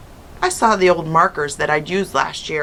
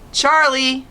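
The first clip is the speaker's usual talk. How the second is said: calling up the stairs; 7 semitones higher than usual. A narrator's reading. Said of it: "my mother called up".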